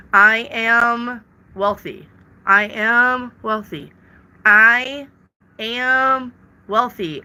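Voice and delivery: slow, deliberate I am wealthy, each word weighted; slow emphatic affirmation. Shown as a demonstration. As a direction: state I am wealthy like a firm vow. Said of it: "when you say".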